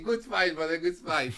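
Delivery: hearty high praise of a rival; imitating a pumped-up fighter praising him; mock hearty praise. Retold friendly praise after fight.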